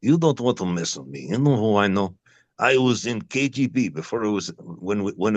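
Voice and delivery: a light mock Russian accent, trying to sound menacing; playful mock-sinister Russian accent. Shown as a demonstration. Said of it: "you just start talking in a Russian accent".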